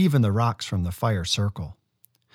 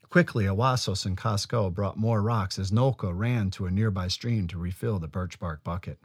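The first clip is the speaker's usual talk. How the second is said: usual speaking voice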